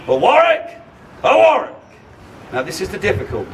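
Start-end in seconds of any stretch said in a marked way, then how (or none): 0.1-1.8 high, loud rallying cry; loud medieval battle cry; battle cry shout. Shown as a demonstration. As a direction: bellow it to the crowd. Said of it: "I will shout".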